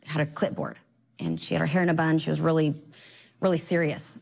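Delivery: ordinary talk, nothing marked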